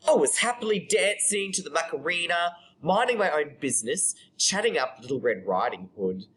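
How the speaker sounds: flowing, animated storytelling; monologue performance. Acted. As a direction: start high and tell it to an audience. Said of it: "read it out to you".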